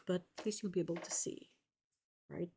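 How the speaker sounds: ordinary talk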